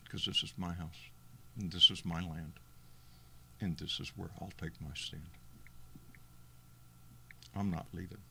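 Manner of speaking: solemn declaration. Real, not acted. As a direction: declare it quietly with resolve. Solemn declaration over music.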